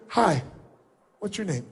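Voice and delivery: shy, nervous hello; timid greeting. Shown as a demonstration. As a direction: greet them like you're scared they'll reject you. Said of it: "like if I came up and said".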